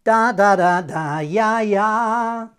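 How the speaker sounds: scatted line ending ya ya with jaw bend, loud start; ya on the G's. Shown as a demonstration. Teacher scats second line with the ya ending. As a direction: sing and smear the final G's. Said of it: "Same deal with those G's at the end".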